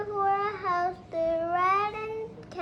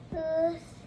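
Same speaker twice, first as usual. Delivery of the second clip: usual speaking voice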